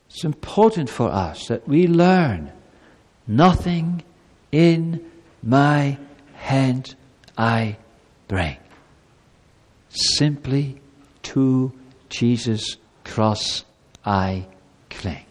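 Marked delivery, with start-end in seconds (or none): 3.3-15.2 slow, low, reverent recitation; hymn recited. Acted. Pastor teaching children the hymn, reverent recitation. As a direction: recite the hymn line reverently, with long pauses. Quotes a hymn verse solemnly.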